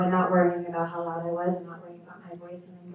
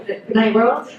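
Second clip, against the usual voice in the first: laughing, higher and louder; lachend, höher, lauter, überglücklich; giddy farewell; 4 semitones higher, 12 dB louder, slower than usual. Real, not acted. Overjoyed after accent praise.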